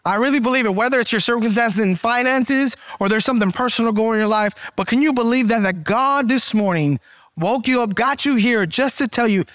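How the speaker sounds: normal talking voice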